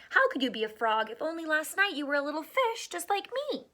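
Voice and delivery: fast, lightly voiced minnow protest; mildly indignant fish, quick; arguing minnow. Minnow argues with the frog.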